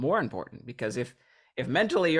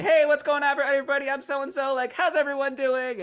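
very high, loud crowd greeting; hammed-up hype host working the room; confident performer; 7 dB louder than usual. Transformed volunteer speaking to the crowd, exuberant performer. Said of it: "now they're a performer and are speaking to the crowd".

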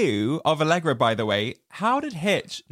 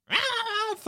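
imitated scream; 3 dB quieter than usual. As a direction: let out a comic little shriek. Imitating Sarah before salad throwing.

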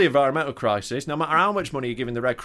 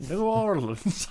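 mock New Orleans accent; 7 semitones higher, slower than usual. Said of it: "do the accent".

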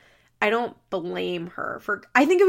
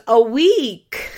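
mock casual; 3 semitones higher, 9 dB louder than usual. Bree's casual reply, retold.

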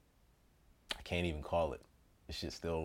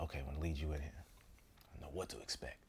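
soft and fast, under his breath; quiet quick retold quote; 8 semitones lower, 17 dB quieter, faster than usual. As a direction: rattle it off quietly. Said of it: "He's like".

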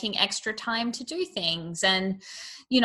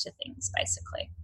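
whisper; 13 dB quieter than usual. Real, not acted. Trailing aside.

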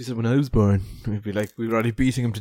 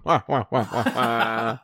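muffled wah wah talk; 4 semitones lower, much slower than usual. Retold or imitated. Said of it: "like an old man with popcorn going".

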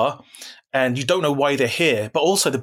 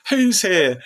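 mock alarm; 9 semitones higher, much slower than usual. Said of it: "You're like".

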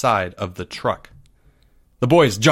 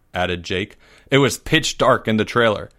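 usual speaking voice

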